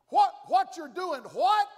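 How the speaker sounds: high, loud rising what; incredulous shout. Pretend. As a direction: bark the question in disbelief. Mock challenge to Elijah.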